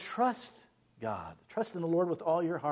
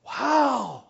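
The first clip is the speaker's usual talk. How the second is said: 1 awed wow; 7 semitones higher, 3 dB louder than usual. Acted. Awe at God's exchange.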